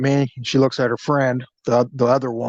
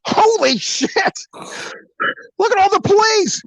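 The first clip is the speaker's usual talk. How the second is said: shocked outburst. Retold or imitated. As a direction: imitate a woman spotting the cops. Retold panic at seeing police.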